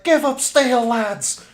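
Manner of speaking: high, loud Scottish battle cry; shouting Scottish guy. Retold or imitated. Streamer imitating a Scottish gamer from a funny video, high shouted accent. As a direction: yell it like a role-playing Scottish soldier. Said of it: "R P-ing as, like, a, like, a Scottish guy".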